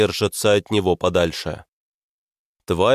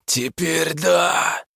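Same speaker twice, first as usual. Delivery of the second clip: eldritch horror; 7 semitones higher, much slower than usual. Acted. Monster answers.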